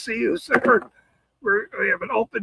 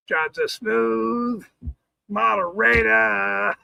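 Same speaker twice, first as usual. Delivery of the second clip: slow drawn-out phrase; much slower than usual. Shown as a demonstration. Streamer repeating a chat joke, drawn out slowly. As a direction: stretch each word out slowly. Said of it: "slow deliberate repeat of phrase".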